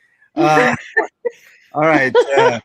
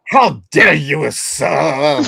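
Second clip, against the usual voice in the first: slow, affronted aristocrat; mock noble outrage, drawn out; aristocratic; 4 dB louder, much slower than usual. Shown as a demonstration. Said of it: "Aristocratically? Like, like".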